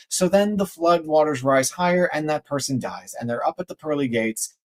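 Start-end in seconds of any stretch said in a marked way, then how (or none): none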